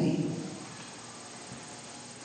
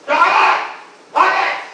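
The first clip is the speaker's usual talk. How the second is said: short, loud high cries; high monster yelps; 12 semitones higher, 6 dB louder than usual. Acted. Water monster gets smaller and smaller, yelping high. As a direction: yelp twice as a shrinking monster.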